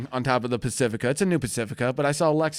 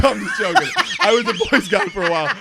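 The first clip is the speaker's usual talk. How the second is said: loud laughing apology; talking through laughter; 5 semitones higher, 8 dB louder than usual. Real, not acted.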